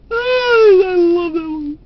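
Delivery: laughing. Real, not acted. Teller still laughing after joke.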